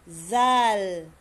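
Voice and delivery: "Zal" with voiced TH, loud, long vowel; tongue between teeth. Shown as a demonstration. Teacher models the letter after explaining it, buzzing TH. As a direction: start "Zal" like "that" and hold it. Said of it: "Paglabas ng konti ng dila".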